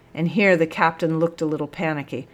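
ordinary delivery

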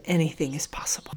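soft, almost whispered; quiet promise to the parents; hushed assurance. A narrator's reading. Narrator's solemn reassurance to parents.

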